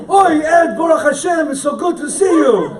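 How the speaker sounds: hammed-up Yiddish accent of a Brooklyn Chabadnik; a friend laughingly imitated in a Yiddish Brooklyn accent; mock Yiddish Brooklyn accent. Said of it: "as if you're born and bred in Yiddish-speaking Brooklyn".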